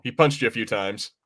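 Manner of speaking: ordinary talk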